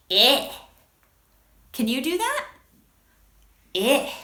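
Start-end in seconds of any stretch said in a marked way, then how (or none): 0.1-0.5 "Eh" as a disgusted short "ih"; yucky short I. Shown as a demonstration. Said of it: "short I sounds like this". 3.7-4.1 short "ih" for "Eh", as if grossed out; yucky short I. Shown as a demonstration. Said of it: "Something really yucky".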